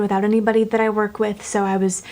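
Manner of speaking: ordinary talk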